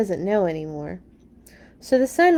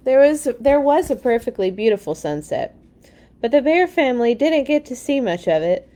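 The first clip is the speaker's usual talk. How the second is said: plain reading voice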